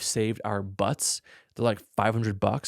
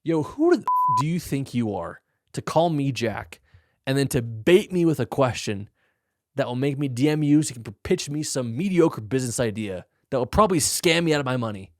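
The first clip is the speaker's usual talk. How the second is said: mock rant; 3 semitones higher, 5 dB louder than usual.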